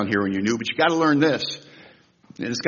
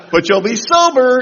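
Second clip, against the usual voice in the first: higher and louder, delighted; gleeful punchline; 11 semitones higher, 7 dB louder than usual. Retold or imitated. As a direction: land the joke with a grin. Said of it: "And then he goes".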